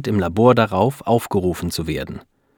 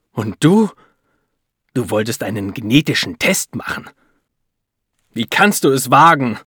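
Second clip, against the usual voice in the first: bitter outburst, leaping high and loud; full of hatred; 5 semitones higher, 5 dB louder than usual. A narrator's reading. Husband turns on his wife over the test, hateful. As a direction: hurl the accusation with loathing. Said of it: "sagt ihr plötzlich voller Hass".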